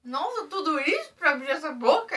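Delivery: loud laughing exclamation. Real, not acted. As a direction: laugh through the line. Laughing at partner's mouth.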